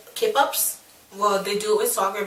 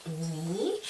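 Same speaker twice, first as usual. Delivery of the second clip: tiny, much softer questioning "me?"; shocked disbelief; 4 semitones lower, 12 dB quieter than usual. Retold or imitated. Graduate hearing own award called, hushed shock. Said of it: "Like, asking questions".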